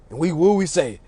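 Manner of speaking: usual speaking voice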